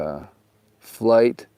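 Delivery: normal talking voice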